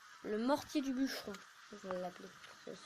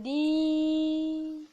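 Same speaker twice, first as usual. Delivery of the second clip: drawn-out sign-off; 4 semitones higher, 4 dB louder than usual. Acted. Outro de vidéo étirée.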